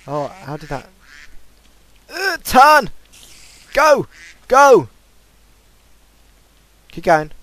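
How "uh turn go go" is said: high, loud frantic shouts; excited yelling. Real, not acted. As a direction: yell it like your life depends on it. Urging mount in game.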